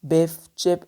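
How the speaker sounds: plain reading voice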